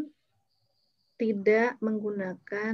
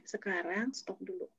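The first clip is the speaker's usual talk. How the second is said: softer, slow, steady command; calm firm stop; 3 semitones lower, 12 dB quieter, slower than usual. Retold or imitated. Aunt halting her quarrelling nieces, slow and quiet. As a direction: settle them down gently but firmly.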